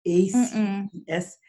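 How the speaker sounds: slow, each letter apart; letters spaced out one by one; spelled out letter by letter. Shown as a demonstration. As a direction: spell it out slowly. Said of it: "the mnemonic called aces?".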